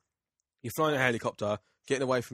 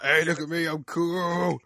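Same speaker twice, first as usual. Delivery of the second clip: high, louder show-off voice; mock cocky swagger, pitched up and drawn out; Knuckles; 7 semitones higher, 5 dB louder, slower than usual. Mocking a dickhead game character to a friend, high show-off voice. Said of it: "he was just like".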